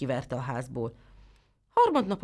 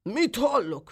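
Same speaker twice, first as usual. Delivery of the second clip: a startled, angry cry, higher and louder; angry outburst; 7 semitones higher, 4 dB louder, slower than usual. Acted. The old tailor hears the goat's complaint, angry outburst. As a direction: blurt it out in outrage. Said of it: "hördült fel a szabó".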